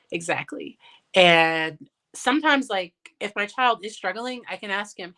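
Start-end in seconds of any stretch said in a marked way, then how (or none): none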